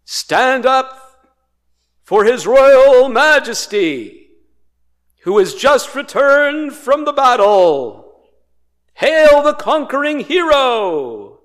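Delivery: high, loud, ringing announcement; imperial herald's proclamation. Acted. Herald hailing the emperor back from battle, loud proclamation. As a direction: command the crowd like a royal herald. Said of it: "That's what a Evangelion would be".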